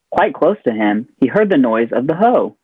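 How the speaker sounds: ordinary delivery, nothing marked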